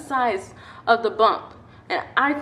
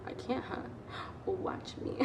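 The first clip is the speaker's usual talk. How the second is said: whispered dare, under the breath; defiant whisper; 18 dB quieter than usual. Retold or imitated. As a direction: hiss it back as a sly challenge. Defiant kid talking back inside.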